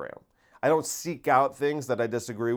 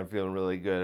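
softer, lower repeat of feeling really good; quietly content; 5 semitones lower, 6 dB quieter, faster than usual. Real, not acted. Talking to viewers, a soft contented repeat. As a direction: say it again to yourself, quietly. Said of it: "I got a lot of energy today".

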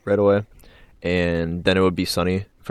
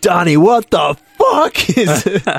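high, loud, drawn-out mock yell; imitated outraged wife; 12 semitones higher, 9 dB louder, slower than usual. Friend quotes movie wife catching her husband, hammed-up high yell. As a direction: shriek it as the appalled wife. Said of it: "his wife is like".

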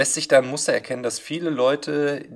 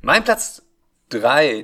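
announcer; 3 semitones higher, 11 dB louder, much slower than usual. Acted. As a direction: boom it out like a host. Announcing the countdown.

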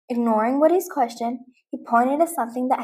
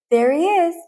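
higher, louder call; shout; 6 semitones higher, 4 dB louder than usual. Acted. Buzz spotting the pirate, shouting. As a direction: point and call it out. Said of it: "Buzz shouted".

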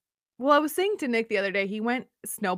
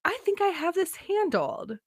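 high, falsely confident inner voice; acted-out overconfidence, pitched up; mock self-assurance; 7 semitones higher, 4 dB quieter than usual. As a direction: chirp it as if all is under control. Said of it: "I go".

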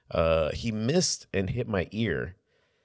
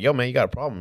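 louder, higher tough-guy challenge; challenging; 5 semitones higher, 5 dB louder, much faster than usual. Retold or imitated. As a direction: square up to him out loud. Squaring up in the bathroom.

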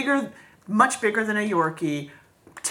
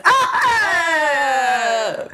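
laughing shriek; 6 semitones higher, 5 dB louder than usual. Real, not acted.

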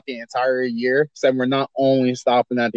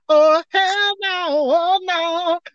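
much higher, slow, drawn-out refusal; exaggerated disbelief; 14 semitones higher, much slower than usual. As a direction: squeal out an outraged no. Mock outrage at rival's pierogi.

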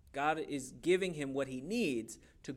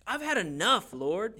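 louder, higher acted outcry; dramatic fed-up plea; exasperated cry to God; 6 semitones higher, 6 dB louder than usual. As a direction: cry out as a prophet at his limit. Acts Elijah's desperate cry to God.

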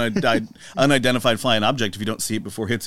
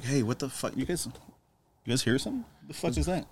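softer, hushed alarmed alien; a quiet startled voice, softer; 9 dB quieter than usual. Retold or imitated. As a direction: hush it like you heard something. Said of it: "And then it's like".

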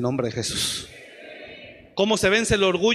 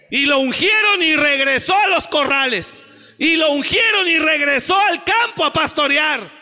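shouted, much higher sermon climax; preaching at full force; 11 semitones higher, 10 dB louder than usual. Acted. Preacher shouting to the congregation, full force. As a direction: hammer it home to the church.

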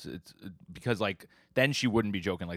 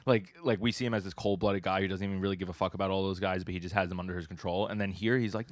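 normal talking voice

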